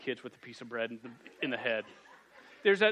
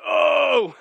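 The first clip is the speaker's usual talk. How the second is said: loud, much higher mock gasp of shock; 11 semitones higher, 12 dB louder than usual. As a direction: gasp like a scandalised parent. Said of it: "and we're like".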